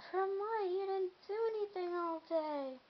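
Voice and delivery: a hint of a scolding parent; mock parent. Vlogger mimicking parents doubting her tiredness. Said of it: "Some parents say".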